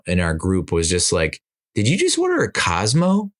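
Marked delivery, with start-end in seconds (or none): 1.8-3.3 incredulous. Retold or imitated. Friend teasing about a Cosmo order.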